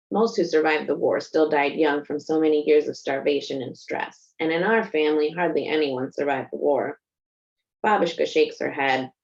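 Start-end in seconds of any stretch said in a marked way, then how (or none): none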